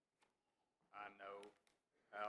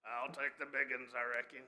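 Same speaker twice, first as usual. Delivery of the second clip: Sling Blade; 3 semitones higher, 13 dB louder than usual. Acted. Attorney cracking up the courtroom, loud Sling Blade impression. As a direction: put on the film character's country voice, loudly. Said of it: "I'm not doing a Sling Blade impression".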